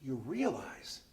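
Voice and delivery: lightly voiced, straight-faced advice; mock serious Bill. Bill tells the colonel, lightly voiced, mock earnest. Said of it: "Bill got that serious look again".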